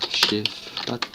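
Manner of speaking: "Chief Pat" in a mock English accent, each word set apart. Shown as a demonstration. Naming an English youtuber to viewers, "Chief Pat" in an English accent. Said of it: "Avec mon super accent".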